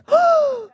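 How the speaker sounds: sharp, high shocked intake of breath; audible gasp. Retold or imitated. Retelling friends' reaction to the mishap, audible gasp. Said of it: "do an audible gasp".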